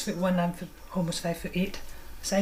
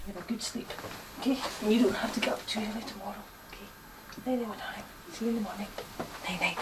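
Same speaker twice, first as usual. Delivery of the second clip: soft bedtime goodnight; 3 semitones higher, 7 dB quieter than usual. Real, not acted. Putting child to bed.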